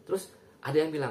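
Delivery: normal talking voice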